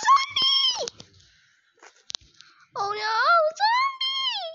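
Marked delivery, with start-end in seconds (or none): 0.1-0.9 very high shrieked zombie; terrified scream. Acted. Student spots a zombie in the dark classroom, terrified scream. 3.6-4.4 very high shrieked zombie; terrified scream. Acted. Student spots a zombie in the dark classroom, terrified scream.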